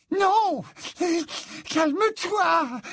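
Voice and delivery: very high, drawn-out singsong wail; singsong Mimi Géniard. A narrator's reading. Ghost girl soothing a boy at the sink, singsong. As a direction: coo it high and piercing, stretching the words. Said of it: "dit la voix chantante de Mimi Géniard".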